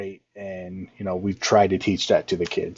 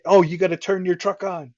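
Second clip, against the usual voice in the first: high-pitched mock advice; mock heckling; 9 semitones higher, 3 dB louder than usual. Coach mimicking unwanted advice to a driver, mock heckling. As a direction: pipe up like a know-it-all onlooker.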